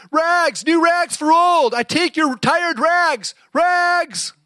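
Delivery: high, loud, slow drawn-out calling; street cry. Acted. Ragman hawking rags in the alleys, loud slow cry. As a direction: sing it out like a peddler. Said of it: "calling in a clear tenor voice".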